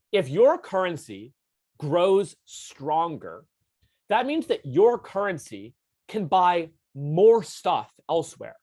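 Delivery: slow right down so the class gets it; slow emphasis. Shown as a demonstration. Teacher slowing for key point.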